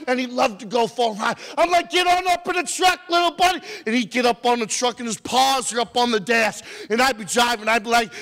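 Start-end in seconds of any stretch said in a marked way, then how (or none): 1.9-3.6 high-pitched talking to a dog; excited urging. Retold or imitated. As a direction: urge the dog along, high and lively. Urges his dog aboard.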